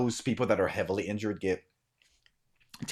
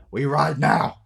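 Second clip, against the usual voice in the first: loud, raised battle cry; rallying shout; 6 semitones higher, 8 dB louder, slower than usual. Acted. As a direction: call the riders to move.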